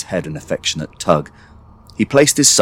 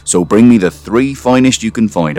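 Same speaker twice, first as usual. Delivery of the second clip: loud, raised voice giving orders; instructing father; 6 semitones higher, 5 dB louder than usual. Acted. Father sends son to the field, loud keen orders. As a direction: rattle off firm demands with gusto.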